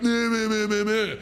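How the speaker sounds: high taunting meh sounds; childish mocking babble. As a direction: bleat it to mock him. Mocking the sergeant's rant.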